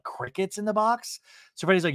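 usual speaking voice

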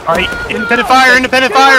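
loud high command; shouting orders over battle. Real, not acted. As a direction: yell it across the field. Calling fire orders in battle.

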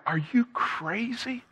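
high, slow retold disbelief; playfully aghast reply; mock incredulous. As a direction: draw out an astonished question, pitched high.

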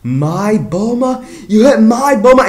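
rising fury at the one who struck his wife; outraged Vegeta. Retold or imitated. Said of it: "He was like".

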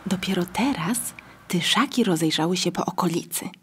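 ordinary delivery, nothing marked

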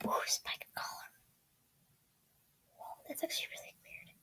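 unvoiced whisper, quick at the end. Real, not acted. Gamer browsing pet items, whispering to herself. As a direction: whisper your reactions to yourself.

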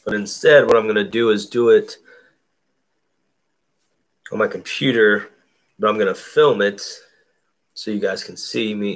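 ordinary talk, nothing marked

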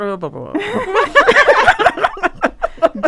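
long, high, loud laugh. Real, not acted. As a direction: crack up laughing. Laughs at mangled comprar.

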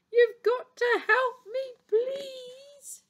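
high, drawn-out wail for help; pleading cry. A narrator's reading. Elephant begs the doctor for help, wailing cry. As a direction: wail the plea, stretching the end. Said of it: "cried Elephant".

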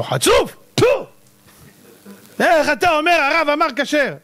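high outburst with a spit; acted indignation. Accuser berating him for contradicting the rabbi, indignant outburst. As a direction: scold in a high voice, spitting mid-rebuke.

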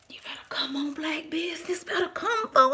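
breathy whispered cheer, rising; excited whisper. Real, not acted. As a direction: hype her up under your breath.

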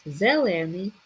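"Зелений" with the middle syllable stressed; "Зелений", demonstrating stress on ле; stress on second е. Shown as a demonstration. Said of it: "наголос на другу е".

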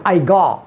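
"go" opened to "gah"; open-mouthed vowel. Shown as a demonstration. Teacher quoting film English, open vowel. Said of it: "والفم، والفم مفتوح".

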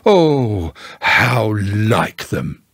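very slow, bitter-sad drag; gloomy lament. A narrator's reading. Eeyore blaming whoever took it, gloomily. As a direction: stretch each word out mournfully.

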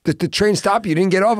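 high, loud mock interrogation; incredulous questioning uncle. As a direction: imitate an uncle grilling his nephew in disbelief. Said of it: "the way he questioned me".

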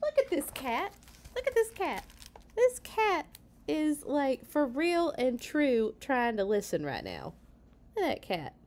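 high-pitched, animated exclaiming; excited aside. Real, not acted. Reader pointing out a cat trying to listen, excited. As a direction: break off to marvel at the pet.

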